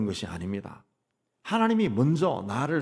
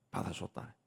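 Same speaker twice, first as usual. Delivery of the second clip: much softer, lower repeat; soft echo; 5 semitones lower, 14 dB quieter, much faster than usual. Acted. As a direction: let it sink in, softly. (훨씬 낮고 조용하게 되뇌듯)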